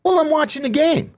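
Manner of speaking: high, put-upon retold excuse; mock protest. Inmate staying put while others bolt, mock protest. Retelling his indifference to a fight.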